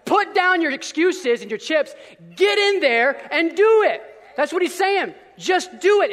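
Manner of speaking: loud, slow exhortation, building; rousing preacher; preaching shout. Real, not acted. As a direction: command them to act.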